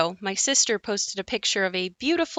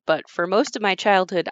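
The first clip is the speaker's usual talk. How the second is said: ordinary delivery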